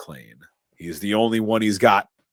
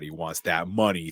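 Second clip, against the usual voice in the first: ordinary talk, nothing marked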